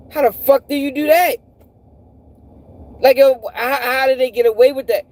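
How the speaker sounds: high-pitched disbelief; incredulous outrage. Real, not acted. Man questioning how a law got away with that, incredulous. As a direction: question it as utterly unbelievable.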